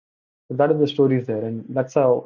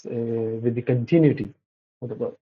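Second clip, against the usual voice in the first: usual speaking voice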